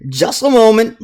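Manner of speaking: high-pitched dwarf brogue; Grisbeck Hearthstone. Acted. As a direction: call out like a jolly dwarf baker. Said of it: "Grisbeck smiles and lays one finger".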